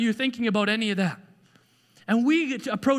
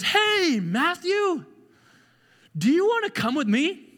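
friendly high call; high, friendly mock call to Matthew; Jesus inviting; 9 semitones higher than usual. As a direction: call out to him gently, inviting. Jesus called out in a retold scene.

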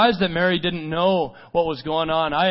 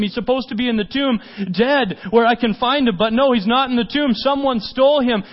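acted distress, higher and louder; distraught protest; 6 semitones higher, 4 dB louder than usual. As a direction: preach Mary's bewildered loss with urgency. Preacher acts out grieving Mary.

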